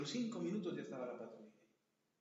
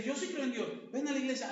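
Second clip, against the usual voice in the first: mocking believer; 6 semitones higher, 3 dB louder than usual. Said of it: "mucha gente dice".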